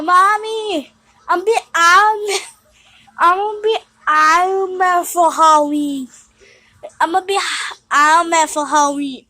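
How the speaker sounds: put-on high, loud little kid voice; baby-talk like a little brother; whiny tattling little sibling. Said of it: "I should talk like my little brother".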